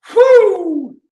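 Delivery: loud high relieved whew; hammed-up whew of relief; high whistling whew. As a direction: blow it out like a near miss. Backing away from bull.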